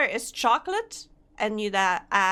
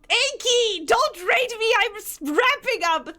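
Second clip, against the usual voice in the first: high, loud playful protest; excited protest; 11 semitones higher, 5 dB louder than usual. Real, not acted. Streamer calling out to Inky about the raid, high and loud. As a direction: call out to the raider in mock panic.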